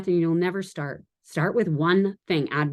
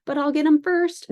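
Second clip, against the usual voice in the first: sassy; 10 semitones higher, 4 dB louder than usual. Pretend. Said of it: "I'm so sassy tonight".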